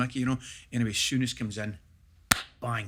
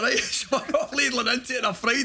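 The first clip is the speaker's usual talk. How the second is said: ordinary talk, nothing marked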